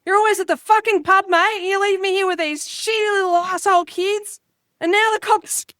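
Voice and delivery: mock a fed-up Aussie wife yelling at her husband; nagging wife voice. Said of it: "the act that I do".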